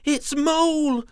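high, loud scared squeak. Acted. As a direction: squeak out in fright. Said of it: "Twirly squeaked".

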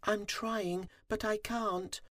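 very high, small, timid voice; nervous little bee, high-pitched; trembling little bee. A narrator's reading. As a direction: plead meekly in a tiny high voice. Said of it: "he trembled".

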